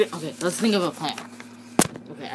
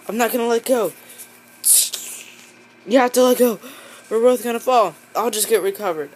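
higher and louder, urgent pleading; straining shout; 6 semitones higher, 8 dB louder, faster than usual. Acted. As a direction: yell while hanging on for dear life. Characters struggle hanging on.

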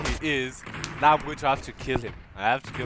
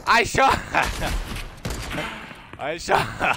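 high-pitched words collapsing into laughter; helpless real laughter; laughing hard; 7 semitones higher, slower than usual. Real, not acted. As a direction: try to repeat it while cracking up. Cracks up at own shot.

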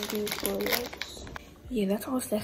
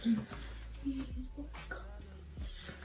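whispering; 10 dB quieter than usual. Real, not acted. Whispers on while getting ready.

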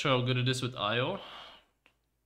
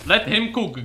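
louder and higher, hyped call; hyped cheer; 9 semitones higher, 9 dB louder, slower than usual. Real, not acted. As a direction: cheer on a teammate. Hyping up the game.

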